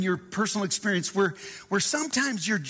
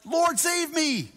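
mock cry for help; 8 semitones higher, slower than usual. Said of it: "cried out".

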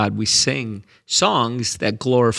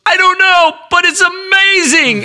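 much higher and louder, bursting with wonder; amazed and excited; 6 dB louder than usual. Retold or imitated. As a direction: blurt it out like a man overwhelmed with joy. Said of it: "and he goes".

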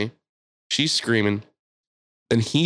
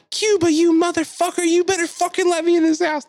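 very high, loud furious scream; imitated yelling; 5 dB louder than usual. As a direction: scream to be let in, imitating her. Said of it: "she's yelling the whole time".

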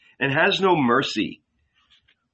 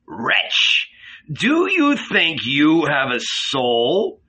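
malicious sneer; 3 semitones higher, much slower than usual. A narrator's reading. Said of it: "guffawed maliciously".

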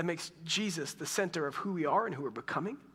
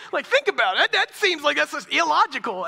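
high, loud, laughing protest; laughing incredulously; 11 semitones higher, 11 dB louder than usual. Real, not acted. Preacher laughing at his own childhood story, incredulous. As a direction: burst out through real laughter.

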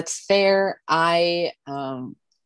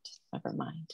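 breathy whisper, giving up; whispered aside; 18 dB quieter than usual. Retold or imitated. Val brushing off non-wrestling friends, in a whisper. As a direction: mutter it under your breath, done explaining.

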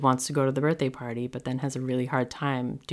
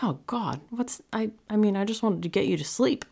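flustered; 7 semitones higher than usual. Retold or imitated. Mom flustered by crying child.

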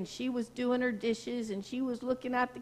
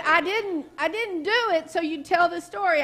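raised, louder mock protest, higher; protesting friend; 6 semitones higher, 5 dB louder than usual. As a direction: insist you didn't do it for the story. Friend protests she gave no gift for stories.

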